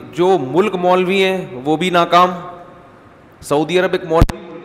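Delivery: ordinary delivery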